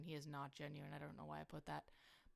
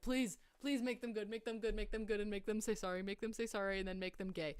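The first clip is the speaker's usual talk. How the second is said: higher, louder pleading chant; mock begging; 3 semitones higher, 6 dB louder than usual. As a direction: beg over and over, hammed-up. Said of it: "I'll be like".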